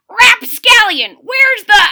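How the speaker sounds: put-on very high, loud old-man holler. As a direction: bellow at the rapscallion.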